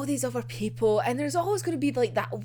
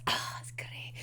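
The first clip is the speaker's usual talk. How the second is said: hushed gleeful whisper; 13 dB quieter than usual. Real, not acted. To the viewers about the arc, a delighted whisper. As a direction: breathe it out like a secret delight.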